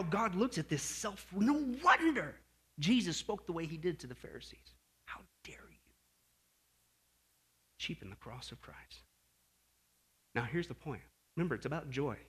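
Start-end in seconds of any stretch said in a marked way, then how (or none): 5.1-9.0 hushed whisper. Real, not acted. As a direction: drop to an intense whisper. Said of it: "whispered, unvoiced".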